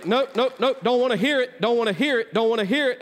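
mock dismissive refusal. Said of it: "they're gonna say".

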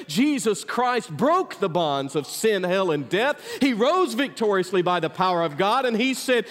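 high, driving preacher's cadence; full-force preaching. Acted. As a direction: preach it out to the whole church. Pastor preaching to the church.

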